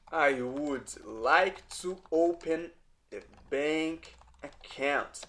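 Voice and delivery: slow, careful, word by word; "I would like to open a bank account" said slowly, each word set apart; slow teaching demo. Shown as a demonstration. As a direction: drag it out so students can follow. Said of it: "teacher repeats the phrase for learners".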